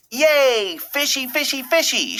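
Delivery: high, squealing delight over a fish; cheering girl. A narrator's reading. Little Darla cheering at her fishy birthday present. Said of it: "she cheered".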